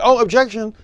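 high, loud whiny protest; a hammed-up mocking objection. Teacher mocking the people who always object, whiny.

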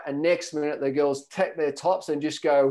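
ordinary talk

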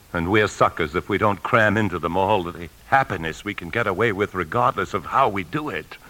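ordinary talk

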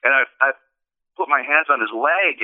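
ordinary talk

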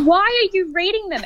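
high-pitched, exasperated question to mum; exasperated retort. Retold or imitated. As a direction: retell it as an exasperated daughter.

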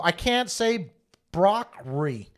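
slow exaggerated brocrri; mock accent. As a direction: pronounce broccoli as brocrri. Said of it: "I go".

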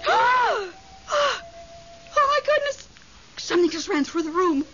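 high, gasping, terrified cry; startled fright. Acted. Widow alone at night, startled by a ghost, terrified. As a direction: jump at something unseen. Something runs through her room.

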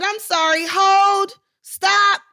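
high, loud interruption building to "stop"; calling a halt. Retold or imitated. New actor halts the scene over her co-star's laughing, loudly. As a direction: cut in over the whole set.

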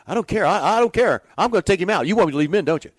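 loud, high, exasperated insistence; heated shout. Retold or imitated. Manager Bobby yelling at his pitching coach about pulling the pitcher. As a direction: bark it like a fed-up manager.

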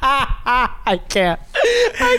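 high, breaking laugh through words; helpless laughter. Real, not acted. Host cracking up at Jared's accent bit. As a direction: laugh so hard you can't talk. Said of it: "Oh my God".

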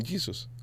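Jesus in English, starting with a J sound; English-style Jesus amid Spanish; English pronunciation. Shown as a demonstration. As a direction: say "Jesus" as "JEE-sus". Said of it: "Y para, para Jesús".

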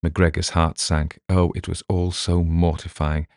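usual speaking voice

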